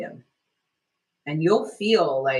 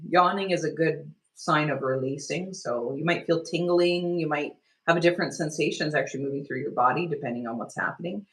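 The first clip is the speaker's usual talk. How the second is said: ordinary talk, nothing marked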